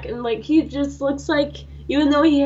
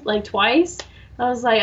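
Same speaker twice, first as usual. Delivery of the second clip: normal talking voice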